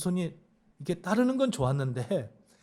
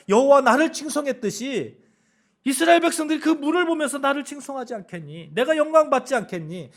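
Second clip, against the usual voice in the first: God's voice, louder and higher, expectant; voicing God; 7 semitones higher, 6 dB louder than usual. God explaining his plan to Moses, raised and expectant. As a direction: speak as God hoping to be praised. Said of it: "하나님께서 ... 뭐라고 얘기냐면".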